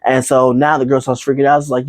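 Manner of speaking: ordinary delivery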